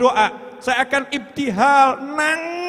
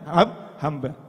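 usual speaking voice

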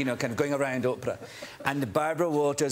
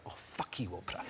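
whispered and much softer; under-breath curse; 8 semitones lower, 13 dB quieter than usual. Retold or imitated. Trying to photograph Oprah as she stands up, whispering a curse. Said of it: "I was like".

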